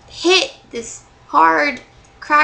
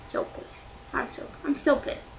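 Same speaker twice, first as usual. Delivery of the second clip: muttering to herself; 10 dB quieter than usual. Real, not acted. Fuming to herself under breath.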